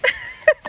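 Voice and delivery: high burst of laughter at the hiccups; a quick sheepish giggle; embarrassed laugh. Real, not acted.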